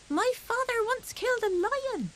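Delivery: high squeaky protest; squeaked. Acted. Fuzzy Peg carried off by a stranger, high and squeaky. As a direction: squeak it out, tiny and scared. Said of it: "he squeaked".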